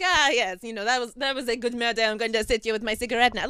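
put-on a smug French burglar savoring his cigarette, in a French accent; hammed-up French accent. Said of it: "What, were they French?".

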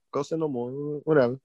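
indifferent, throwaway shrug of a reply; meh. Retold or imitated. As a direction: brush it off with a meh. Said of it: "I was like, meh to it".

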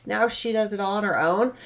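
ordinary talk, nothing marked

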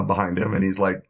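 ordinary talk

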